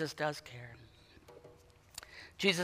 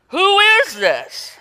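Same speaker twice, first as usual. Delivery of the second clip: very high, slow, breathless wonder; awestruck disciples; 12 semitones higher, 5 dB louder, much slower than usual. Acted. Disciples awed at Jesus calming the storm, high and slow. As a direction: let it hang in stunned awe.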